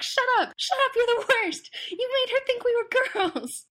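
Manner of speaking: mock yelling. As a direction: yell it at him like an exasperated roommate. Roommates yelling at the douchebag.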